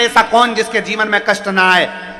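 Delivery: high, loud rhetorical sermon; rousing preacher piling up challenges; full-force preaching. Real, not acted.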